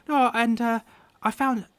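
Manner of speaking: put-on high, halting child voice; little lad insisting on his story; Carp, young boy. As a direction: tell it as an eager small boy. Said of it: "And Carp pipes up".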